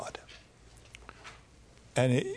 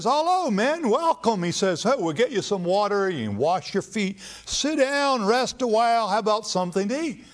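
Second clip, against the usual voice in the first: eager host greeting; 8 semitones higher than usual. Retold or imitated. Abraham eagerly greets three travelers.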